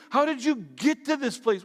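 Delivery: usual speaking voice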